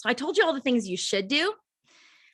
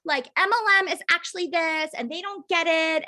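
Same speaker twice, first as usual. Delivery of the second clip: higher-pitched mock of a preachy post; mocking quote; 7 semitones higher than usual. Mocking a defensive online post to the team. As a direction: parody someone over-explaining their business. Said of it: "and it's like".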